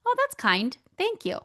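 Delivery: high, bright-pitched gracious thank-you; just accept it sweetly, voice lifted high; lightly sung-up thank you. Retold or imitated. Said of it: "instead of just saying".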